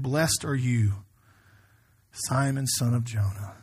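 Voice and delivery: slow, low, measured scripture reading; tender blessing. Acted. Jesus blessing Simon, spoken slowly and tenderly. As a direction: let each phrase of the blessing settle.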